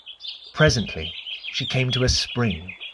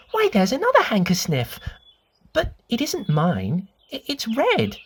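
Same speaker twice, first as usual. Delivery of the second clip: a hint of a curious little girl, higher; lightly voiced little girl; 7 semitones higher than usual. A narrator's reading. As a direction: ask like a small girl, wide-eyed. Lucy voiced in story.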